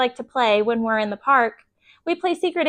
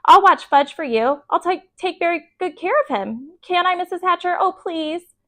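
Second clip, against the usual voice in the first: put-on higher, pleading child voice; eager begging girl; 6 semitones higher than usual. A narrator's reading. Sheila begging Peter's mother to babysit, pleading. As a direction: beg eagerly to be allowed. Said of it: "begged some more".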